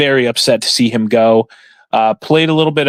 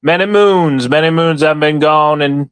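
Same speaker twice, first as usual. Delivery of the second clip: hammed-up Southern farmer, stretched out. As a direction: spin a yarn like a Southern gentleman farmer. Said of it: "I can't keep doing the voice too long".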